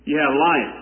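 normal talking voice

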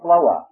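Floa with the R left off; two-beat Floa, no R; southern Georgia pronunciation. Shown as a demonstration. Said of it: "No R in the word".

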